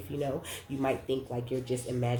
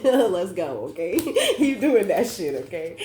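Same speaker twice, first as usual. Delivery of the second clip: laughing hype; 6 semitones higher, 9 dB louder than usual. Real, not acted. Hyping up the viewer.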